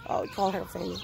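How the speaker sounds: usual speaking voice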